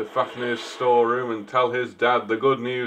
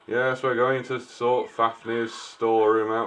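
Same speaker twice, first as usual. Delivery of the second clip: normal talking voice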